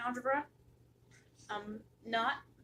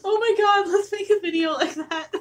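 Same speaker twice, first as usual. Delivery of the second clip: thrilled high burst of excitement; excited; 9 semitones higher, 10 dB louder than usual. Real, not acted. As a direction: squeal with a sudden idea. Excited idea, shouting.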